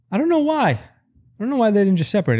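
shrill-free high exasperated rush; exasperated outburst. Real, not acted. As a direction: throw up your hands in disbelief.

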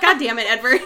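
loud, laughing exasperation; exasperated outburst. Acted. Host curses a hapless character, laughingly exasperated. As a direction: burst out at him, amused and fed up.

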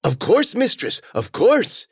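high, obliging voice, slowing at the end; eager servant. Acted. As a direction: rush to please the mistress. Servant obeys his mistress.

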